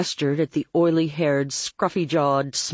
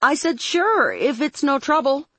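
high, projected sing-out; calling up; 11 semitones higher than usual. A narrator's reading. Dory covers up to Claire, calling up. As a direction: call it up the stairs in a high voice. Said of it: "I called up".

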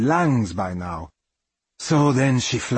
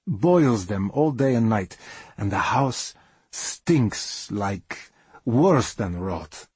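usual speaking voice

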